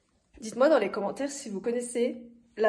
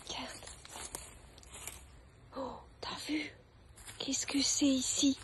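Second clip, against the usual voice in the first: soft hushed whisper; 3 semitones higher, 10 dB quieter than usual. Real, not acted. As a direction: whisper it, as if not to wake anyone. Showing something quietly, hushed.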